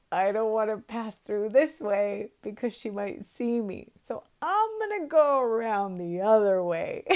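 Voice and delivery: real laughter, loud and high through the words; laughing while talking. Real, not acted. Retelling Juan Diego dodging her, laughing loudly. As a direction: push the lines out through giggles. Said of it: "I'm cracking myself up".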